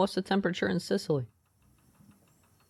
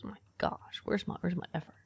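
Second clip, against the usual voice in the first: breathless whisper; whispered dismay; 8 dB quieter than usual. Real, not acted. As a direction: hiss it under your breath in disbelief. Dismayed at the sparse newspaper.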